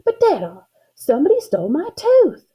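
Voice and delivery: high, upset little cry of protest; indignant shout. A narrator's reading. Girl complaining to Dada about her tooth, indignant shout. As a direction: protest loudly, pitch climbing high. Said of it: "I kind of shouted".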